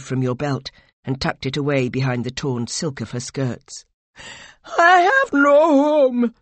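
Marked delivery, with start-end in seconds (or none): 4.6-6.3 slow, high, loud wail; distraught, drawn-out shout; anguished cry. A narrator's reading. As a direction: wail it out in grief. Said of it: "she cried".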